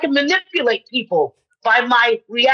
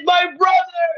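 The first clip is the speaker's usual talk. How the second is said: fake sobbing; 9 semitones higher than usual. Fake crying to teacher.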